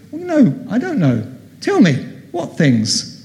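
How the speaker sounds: high-pitched feigned ignorance, then settling; playing innocent. Jesus going along as if he knows nothing, innocently. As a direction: act clueless to draw them out. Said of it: "he still goes along with this thing of".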